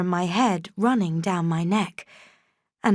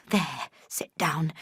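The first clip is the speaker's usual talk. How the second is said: soft breathy whisper; whispered order; 3 semitones lower, 7 dB quieter than usual. A narrator's reading. Servant pushing Jane onto chair, whispered. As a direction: hush her into the chair.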